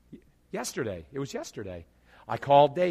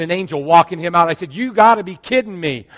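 loud amazement; 3 semitones higher, 14 dB louder than usual. Real, not acted. Climax of the retold story.

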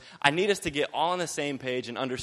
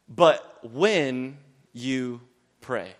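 slow emphatic reading; 4 dB louder, much slower than usual. Shown as a demonstration. Preacher reading the verse slowly to the congregation. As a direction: read each word slowly and deliberately. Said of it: "Jesus is trying to get some point across".

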